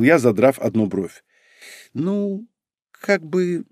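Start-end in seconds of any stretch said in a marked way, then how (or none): 1.9-3.6 high, soft, halting reply; hesitant. A narrator's reading. Girl caught having spent all her money, hesitant. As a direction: falter sheepishly between the words. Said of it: "замялась она".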